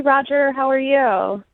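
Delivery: ordinary talk, nothing marked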